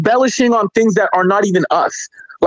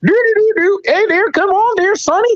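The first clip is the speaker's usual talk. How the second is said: high, hammed-up American Southern accent; mock Southern accent; 12 semitones higher than usual. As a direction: caricature a Southerner, pitched way up. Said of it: "everybody in the South of America who's like".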